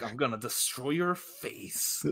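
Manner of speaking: quiet slow menace. Retold or imitated. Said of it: "and he's like".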